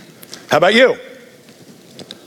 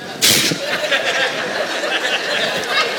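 soft laugh; 6 semitones higher, 7 dB quieter than usual. Real, not acted. Preacher laughs.

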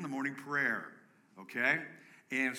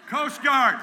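much higher, full shout; 12 semitones higher, 13 dB louder than usual. Real, not acted. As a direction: really belt the name out. Calling out again, shouting.

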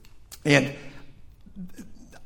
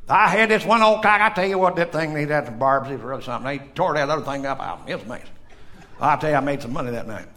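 put-on thick South Carolina accent; faster than usual. Shipmate bragging about chicken fights, thick South Carolina accent. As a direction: ramble nearly unintelligibly in a South Carolina accent. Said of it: "I don't understand the thing you just said".

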